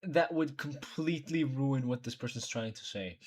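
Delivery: ordinary delivery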